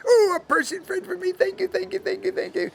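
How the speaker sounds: put-on high-pitched gushing child voice; overjoyed little girl. Lonely girl thanking her new friend, high and gushing. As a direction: squeal it high, thanks tumbling out.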